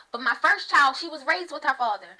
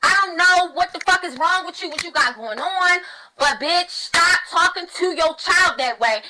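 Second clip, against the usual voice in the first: loud, higher, scolding a stranger on the bus; furious scolding; 4 semitones higher, 5 dB louder than usual. Retold or imitated. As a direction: tell off a mother for how she talks to her daughter. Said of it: "the Holy Spirit jumped out of me and said".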